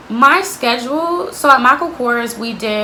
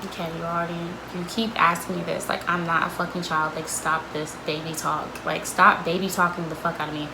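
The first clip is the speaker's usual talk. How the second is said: muttered inner reply; 4 semitones lower, 7 dB quieter than usual. Retold or imitated. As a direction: lower and much softer, fed up. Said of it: "And I'm just like".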